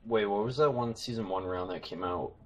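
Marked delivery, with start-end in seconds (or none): none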